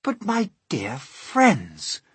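put-on high, slow old grasshopper; rally your friends with drawn-out cheer; old grasshopper crying out encouragingly; trying to be cheerful. A narrator's reading. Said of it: "cried the old ... trying to be cheerful".

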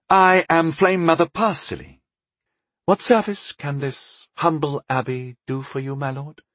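ceremonious matron greeting a lord; Flame Mother Parsilly. A narrator's reading. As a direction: open high and strong, then politely offer service. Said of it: "she asked".

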